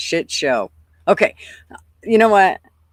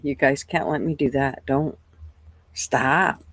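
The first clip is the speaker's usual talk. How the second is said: ordinary talk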